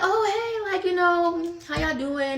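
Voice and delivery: high, friendly retold hello; bubbly greeting. Acting out bubbly greeting back.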